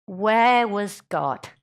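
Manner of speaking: slow, drawn-out Where was God; a friend's slow, grave question; searching question. Retold or imitated. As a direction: ask it slowly and gravely.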